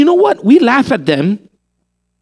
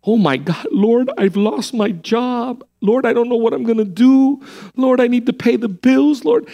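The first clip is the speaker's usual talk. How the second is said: mock freak-out; 4 semitones higher than usual. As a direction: whine a panicked prayer, exaggerated. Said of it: "We freak out about some things".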